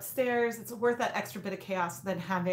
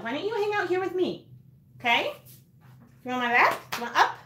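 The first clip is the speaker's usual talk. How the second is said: higher, sweet coaxing; coaxing the dog; 7 semitones higher, faster than usual. Real, not acted. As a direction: talk the dog up onto the lap.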